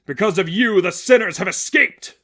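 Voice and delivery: accusing shout. Acted. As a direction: lash out in blame. Villain accusing at gunpoint.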